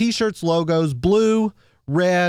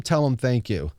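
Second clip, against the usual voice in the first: ordinary talk, nothing marked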